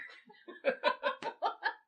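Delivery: high, loud burst of laughter; real laughing fit. Real, not acted. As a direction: crack up helplessly mid-story. Cracks up while retelling the film.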